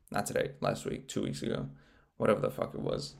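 muttered aside. Real, not acted. Muttered self-correction aside.

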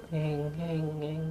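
soft "ngeng" swinging sound; imitated swinging noise. Storyteller imitating the ghost at play, soft swinging noise. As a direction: quietly mimic something swaying to and fro.

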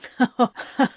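warm rich laugh; a rich amused chuckle. A narrator's reading. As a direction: chuckle at the joke. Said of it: "Anthony chuckled".

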